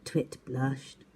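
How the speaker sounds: ordinary delivery, nothing marked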